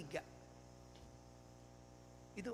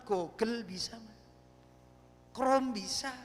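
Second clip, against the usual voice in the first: normal talking voice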